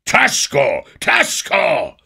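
hohe, laute Wutrufe; zornerfüllter Schrei. A narrator's reading. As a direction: schleudere es zornig heraus. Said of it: "schrie der König zornerfüllt". (König schreit die Fremden wütend an)

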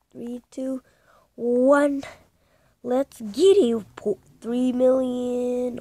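loud, drawn-out milestone cheer; excited countdown. Real, not acted.

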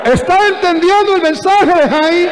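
very high, urgent pulpit shout; rousing shout. Real, not acted. Preacher checks congregation follows the message, urgent shout. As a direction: holler the question out to the crowd.

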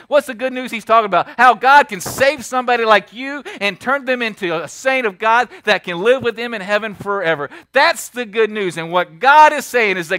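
loud, driving pulpit preaching; rally the congregation; building revival sermon; full-force preaching. Acted.

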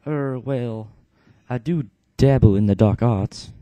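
loud, hesitant British shaman; British mystic, a little sheepish; British accent. Acted. Canius explaining his magic, British accent. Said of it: "Canius is gonna have the British accent".

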